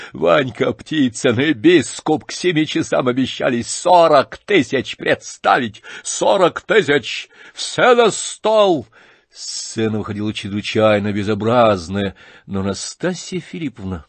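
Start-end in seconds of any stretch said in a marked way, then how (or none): 0.1-8.9 high loud slow shouting. Acted. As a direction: keep bellowing the bid. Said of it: "закричал Рогожин".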